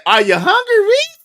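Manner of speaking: high lilting mother voice; mom's sing-song call. Retold or imitated. Mother sing-songing to her son. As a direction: call out sweetly like a mom. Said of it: "he said".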